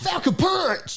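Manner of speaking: high, slow battle cry; Falcon punch shout. Retold or imitated. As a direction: yell the move name. Quoting the game move.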